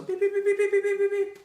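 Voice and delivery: rapid high, loud beeps imitating a whistle; sharp piercing mimicked whistle beeps; whistle blasts. Trainer imitates blowing a whistle for her missing dog, high loud beeping. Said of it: "I get out my whistle".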